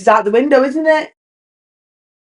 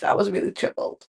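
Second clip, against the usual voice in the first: softer, whispered joking confession; whispered aside; 3 semitones lower, 12 dB quieter than usual. Acted. Confessing to viewers she was troubled, whispered. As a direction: drop to a whisper as if confessing a secret.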